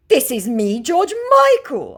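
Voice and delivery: high, loud mocking scammer. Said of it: "scammer claims to be [name]".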